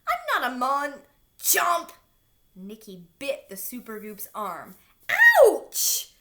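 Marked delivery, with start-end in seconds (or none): 0.1-1.0 cut-off shout. A narrator's reading. Rip yells, gets cut off. 1.4-1.9 biting sound. A narrator's reading. Bite sound effect. 5.1-6.1 very high, loud yelp; pained shout. A narrator's reading. Blob bitten by Nikki, loud pained yelp. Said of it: "the blob shouted".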